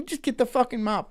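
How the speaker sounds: ordinary talk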